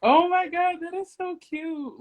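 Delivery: high, gushing over something cute; delighted. Real, not acted. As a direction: squeal at how cute it is. Delighted reaction.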